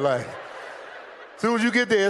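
ordinary delivery

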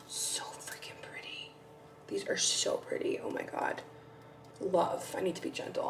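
real whispered admiration; gasp, then whisper in awe; hushed awe. Real, not acted. Admiring pampas grass from her bestie, in a hushed whisper.

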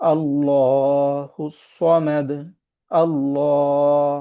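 very slow, drawn-out tajweed chant; Quran recitation. Acted. Teacher reciting the verse for the class, slow chant. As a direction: recite it slowly like a qari.

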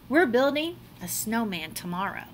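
usual speaking voice

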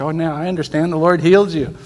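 a jubilant cry that the Lord healed him; excited exclamation. Retold or imitated. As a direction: shout it out, overjoyed.

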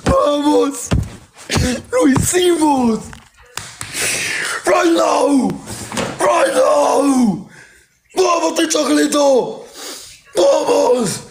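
high, victorious yelling; triumphant shout. Real, not acted. Celebrating win on stream. (grito de victoria)

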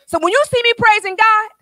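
full-force preaching. Real, not acted. Preacher telling the church why she praises God, full-force preaching. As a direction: drive it home to the congregation at full voice.

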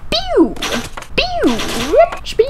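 very high sliding pew; imitated cartoon launch noise; pew sound effect. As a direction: squeak out high falling pews.

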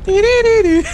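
very high, loud sing-song noise; high silly chant. Pretend. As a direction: squeal a goofy little tune. Silly sound after voice remark.

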